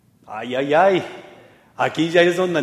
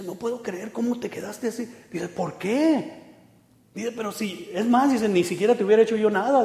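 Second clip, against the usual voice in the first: amazed boss; 6 semitones higher than usual. Retold or imitated.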